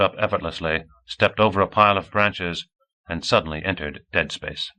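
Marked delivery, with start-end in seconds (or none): none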